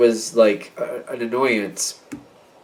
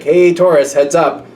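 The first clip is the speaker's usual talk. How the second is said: raised, louder heads-up to a friend; called-out warning; 5 semitones higher, 5 dB louder than usual. Acted. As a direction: call out a playful alert. Acting out friends calling a warning.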